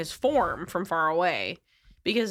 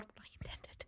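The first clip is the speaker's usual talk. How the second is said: hushed, breathy aside, barely voiced; horrified whisper to a partner mid-ambush; 26 dB quieter than usual. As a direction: whisper it so the victim can't hear. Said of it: "Corvula's kinda like".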